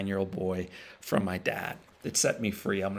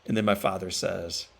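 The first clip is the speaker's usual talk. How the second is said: ordinary talk, nothing marked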